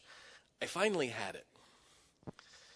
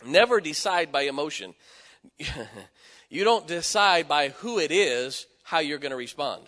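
raised, forceful sermon voice; emphatic preaching; 5 semitones higher, 8 dB louder than usual. Real, not acted. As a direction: preach it with conviction, louder.

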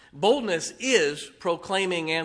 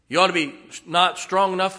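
usual speaking voice

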